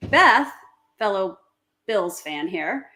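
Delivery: normal talking voice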